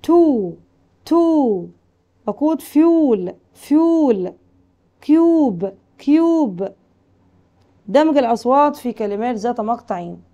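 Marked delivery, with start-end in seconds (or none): 0.0-1.7 تو with a long held "oo"; long U demo. Shown as a demonstration. Teacher modelling long U for pupils, held "oo" in تو. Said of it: "اليو بقى". 2.6-6.7 فيول and كيوب with a stretched "yoo"; long U demo. Shown as a demonstration. Teacher drilling long U for pupils, stretched "yoo" in فيول and كيوب. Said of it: "اليو بقى".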